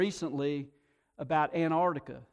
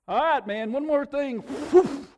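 loud, higher, hammed-up wrath; mock angry God; 7 semitones higher, 7 dB louder than usual. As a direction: play a God itching to throw lightning. Imagined God hurling lightning bolts.

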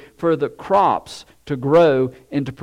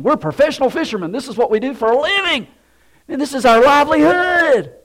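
rising higher and louder into a mock protest; hammed-up professional pride, pushing back; indignant fishermen; 8 semitones higher, 4 dB louder than usual. Fishermen protesting Jesus's order, higher, mock indignant.

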